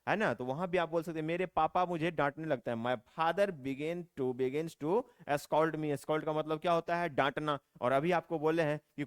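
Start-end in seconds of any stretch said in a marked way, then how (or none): 2.8-6.0 very slow, word by word; slow dictation. Shown as a demonstration. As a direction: read it slowly so learners can copy. Teacher reads model sentence. (धीरे धीरे, शब्द-शब्द)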